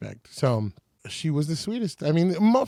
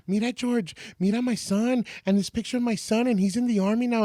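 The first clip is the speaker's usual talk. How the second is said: gushing fan; 6 semitones higher than usual. Retold or imitated. Fans pestering George Lopez.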